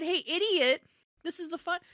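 high, jabbing hypothetical insult; mock rude email. Author showing what an aggressive email would be, mock rude. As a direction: exaggerate the rudeness, punching "idiot". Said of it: "it's not like I said".

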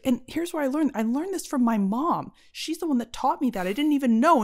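earnest retelling. Retold or imitated. Girlfriend retelling her cooking story, earnest.

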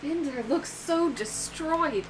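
mild dismay; lightly voiced Braxis. Knight looking at the ruined town, slow and dismayed.

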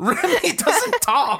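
high, drawn-out laughing protest; mock outrage. Real, not acted. Co-host disputes that the rat talks, mock outrage. Playful argument about Remy talking.

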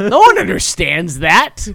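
high, slow disbelieving question; incredulous. Real, not acted. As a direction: ask it in amused disbelief. Incredulous ranting, laughing.

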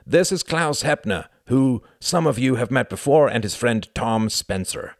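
high, loud, falsely hearty introduction; ringingly false. A narrator's reading. Count introducing two guests to his men, ringingly false. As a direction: ring it out like an insincere host. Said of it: "in his ringingly false voice".

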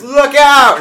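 loud, high "Look out!" cry; hammed-up shouted warning to the crew. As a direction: yell it as the ship seems to lurch. Captain warns crew of hard turn.

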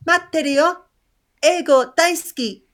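high put-on child voice in Japanese; mock schoolkid. Co-host mocks the kids' supposed message to teachers, high child voice. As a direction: imitate a kid reciting a scripted message, high. What kids would not really say.

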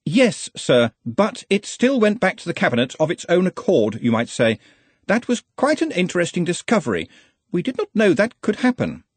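high-pitched, insistent subordinate; earnest, fussy assistant. Acted. Ponder explains.

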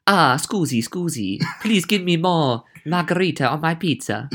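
hammed-up Italian accent with a laugh in it. Host performs the pizza phrase on request, mock Italian accent. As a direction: play a polite, sing-song Italian diner.

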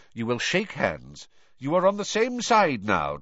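emphatic, increasingly urgent command; impatient leader demanding unity; bite of impatience. Acted. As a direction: insist firmly, voice rising as it goes. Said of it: "with a bite of impatience in his voice".

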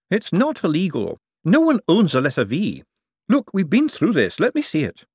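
put-on a young buyer pushing back; young man's voice. Young man insisting to the old forger, impatient.

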